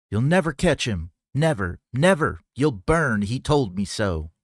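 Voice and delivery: high, loud, frantic shriek. A narrator's reading. Possessed woman shrieks at the gunslinger in panic. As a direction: shriek it at him in terror.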